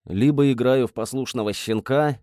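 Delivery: ordinary delivery, nothing marked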